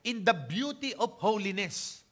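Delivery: slow, solemn psalm recital in a changed voice; scripture reading. Acted. Preacher reading David's psalm to the congregation, slow and solemn. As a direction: proclaim the verse like King David. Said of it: "Sabi niya".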